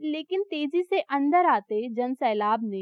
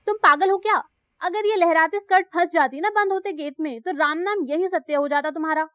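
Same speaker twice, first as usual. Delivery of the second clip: angry scolding; 3 semitones higher, 5 dB louder, faster than usual. Acted. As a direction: snap at her for being careless. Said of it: "गुस्से से आई इस आवाज".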